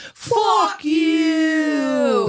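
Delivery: long loud shouted curse. Real, not acted. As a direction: bellow it out. Shouted fuck you segment.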